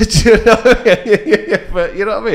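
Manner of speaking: laughing loudly through the words. Real, not acted. Podcast guest laughing loudly while recalling darts night. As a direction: laugh it out, can't hold it in.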